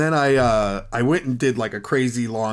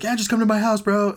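high laid-back dude voice; 11 semitones higher, 3 dB louder than usual. Retold or imitated. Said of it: "the guy's like".

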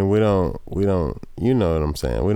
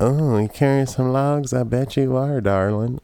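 sexy Southern woman; 5 semitones higher than usual. Retold or imitated. Tammy Lynn voiced.

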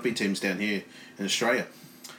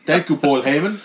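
louder Bulgarian accent, mock thanks; sarcastic Bulgarian wrestler thanking his boss; 3 semitones higher, 6 dB louder than usual.

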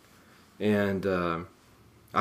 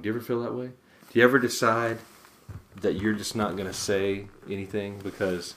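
normal talking voice

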